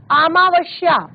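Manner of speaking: अमावस्या with sh, "amaavashya"; spoken mispronunciation. Shown as a demonstration. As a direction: turn the s of अमावस्या into sh. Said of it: "बोलते वक्त".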